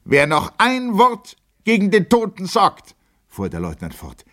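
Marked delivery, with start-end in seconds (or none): 0.0-2.9 very high, loud warning; furious threat. A narrator's reading. Lieutenant threatens anyone insulting the dead, furious threat. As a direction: warn them all at a raised, high pitch.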